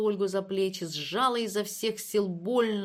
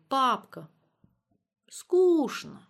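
put-on high, louder little-kid whine; child's complaint; 5 semitones higher, 5 dB louder, much slower than usual. A narrator's reading. Little Lyubka telling her father she's bored, complaining.

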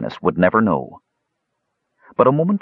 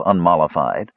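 ordinary delivery

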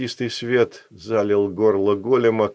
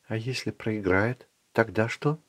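softly asked, much quieter; quiet question; 7 dB quieter than usual. Acted. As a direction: ask it quietly, almost hushed. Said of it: "она спросила это тихо".

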